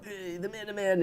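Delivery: lightly imitating the announcer's catchphrase; imitating Raftery's lines. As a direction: toss off the coach's line in a light impression. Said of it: "he does these lines".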